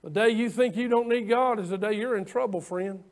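usual speaking voice

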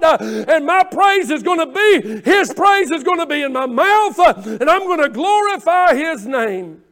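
rising sermon climax; fervent preaching. Acted. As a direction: lift it into full gospel fervor. Preaching at full force.

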